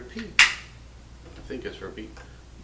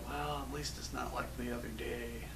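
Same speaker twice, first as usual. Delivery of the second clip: soft, understated grumble to oneself; resigned soldier quote; 13 dB quieter than usual. Acted. Soldier putting up with discomfort, soft resigned remark. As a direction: say it very quietly, making do. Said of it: "You're like".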